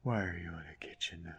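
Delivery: tired whispered grumble; weary whisper. Real, not acted. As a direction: whisper it, worn out by the game. Whispered asides.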